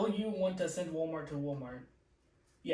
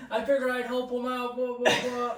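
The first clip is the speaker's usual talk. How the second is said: higher, louder mock condescension; condescending guest; 7 semitones higher, 5 dB louder than usual. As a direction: imitate smug charity, tossed off as blah-blah. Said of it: "condescending right from the start".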